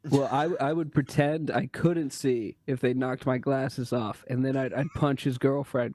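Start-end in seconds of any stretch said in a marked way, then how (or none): none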